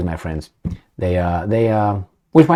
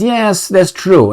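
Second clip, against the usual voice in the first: mock admission; 9 semitones higher, slower than usual. Imagined minister admitting it.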